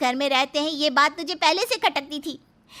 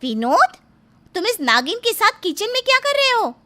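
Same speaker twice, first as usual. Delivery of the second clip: high, outraged questioning; shocked accusation; 9 semitones higher than usual. Acted. Wife catching her husband in the kitchen, shocked accusation. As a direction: demand an answer, scandalised and high-pitched.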